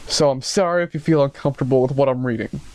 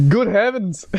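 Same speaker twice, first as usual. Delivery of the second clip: high, shocked British commissioner; cried out; 8 semitones higher, slower than usual. Acted. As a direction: exclaim like a scandalized commissioner. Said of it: "the commissioner cried out".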